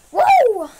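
high loud celebratory woo; excited whoop. Real, not acted. Gamer cheering his own throw, loud whoop. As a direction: whoop at the big play.

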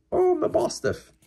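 very high excited exclamation; delighted surprise. Real, not acted. Spotting a rare card, high surprised delight. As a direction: gasp out the name in delight.

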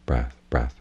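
normal talking voice

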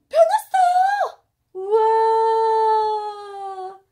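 very high, loud, stretched exclamation; excited cry. Real, not acted. Mom cheering the red capsule's change, excited. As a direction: shout it out in delight.

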